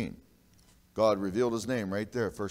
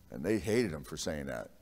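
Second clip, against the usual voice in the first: normal talking voice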